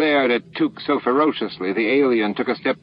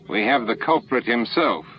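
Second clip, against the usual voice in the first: plain reading voice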